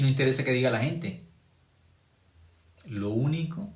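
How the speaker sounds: ordinary talk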